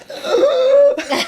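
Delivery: loud, high-pitched choking wheeze; wheezing gasp. Retold or imitated. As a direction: reenact a desperate gasp for air.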